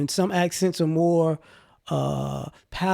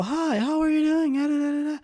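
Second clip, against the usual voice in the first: very high, put-on polite phone voice; high sing-song mock politeness; mother's phone voice; 11 semitones higher than usual. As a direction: imitate mom code-switching for a caller. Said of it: "She'd use her regular voice".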